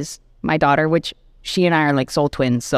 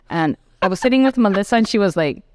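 normal talking voice

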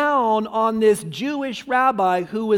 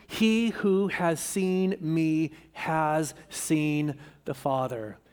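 slow hushed words; 3 semitones lower, 5 dB quieter, slower than usual. Acted. Jesus answers Philip, slowing down.

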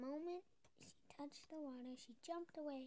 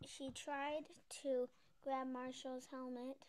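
plain reading voice

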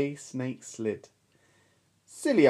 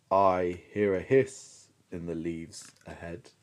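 Gruffalo; 8 semitones lower than usual. Acted. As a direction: rumble it deep and wary. Gruffalo speaks.

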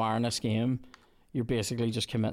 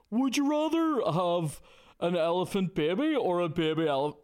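mocking quote; 7 semitones higher than usual. Streamer mocking viewers' silly questions, high mock voice. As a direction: parody the viewer's dumb question. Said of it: "See the questions that are like".

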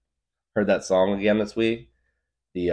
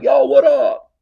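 hyped greeting; 13 dB louder, slower than usual. Retold or imitated. Excited greeting in retold scene.

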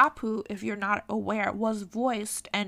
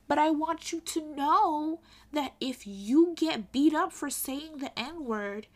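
ordinary delivery

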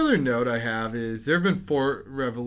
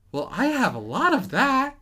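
high, loud, weird put-on voice; Johnny Depp's Willy Wonka; 12 semitones higher, 7 dB louder than usual. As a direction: do an odd, high-pitched chocolatier impression. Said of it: "my best Johnny Depp Charlie".

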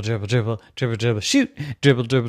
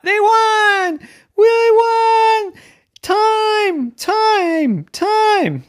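very high, loud cheers; jubilant cheering; 8 dB louder, much slower than usual. Acted. Team celebrates the winning shot, jubilant cheering. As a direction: cheer with long, drawn-out cries.

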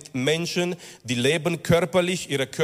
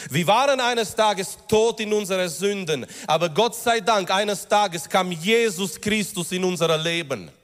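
higher, driving sermon voice; impassioned preaching; 4 semitones higher than usual. Acted. Preacher urging the congregation about their rebirth, impassioned. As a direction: preach it with rising fervour. Preacher rises to full force.

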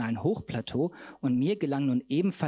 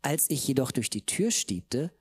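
plain reading voice

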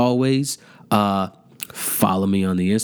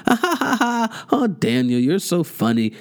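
mocking yes-men; 6 semitones higher than usual. Imitating Daniel's yes men laughing at everything, mocking. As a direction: fake high laugh, then simpering praise. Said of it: "they just laughed and".

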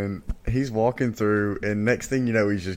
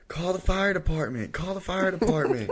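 high, pitched-up imitation of a panicked yell; mock panicked scream; 7 semitones higher than usual. Brother imitates the trapped boy yelling up from the sinkhole, mock panic. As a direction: play the trapped brother yelling for rescue, half-mocking. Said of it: "David's screaming".

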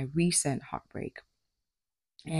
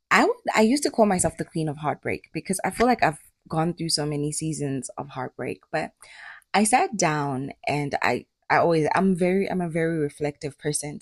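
usual speaking voice

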